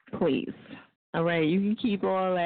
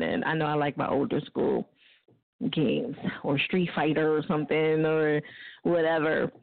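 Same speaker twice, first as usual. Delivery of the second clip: usual speaking voice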